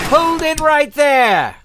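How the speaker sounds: loud, drawn-out order into the dark garage; commanding shout. Acted. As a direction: freeze the intruder with a shout. Confronting intruder in garage.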